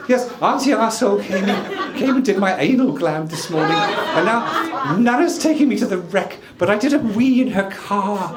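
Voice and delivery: high, loud little dog voice; Pretty the dog's voice. Retold or imitated. Man voices wife's little dog on the phone, high and loud. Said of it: "So Pretty will say".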